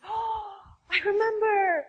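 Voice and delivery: high, bright burst of remembering; light up as the memory lands; excited recognition. Retold or imitated. Girl recognizes her from last year.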